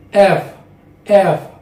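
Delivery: "F" demonstrated as the unvoiced partner of V; unvoiced F sound. Shown as a demonstration. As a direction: say "F" clearly, with a voiceless f sound. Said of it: "it means unvoiced sound".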